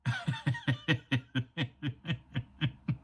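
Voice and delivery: long low chuckle; laugh. Real, not acted. Preacher amused after the cub's speech, long chuckle. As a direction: let out a rolling low laugh.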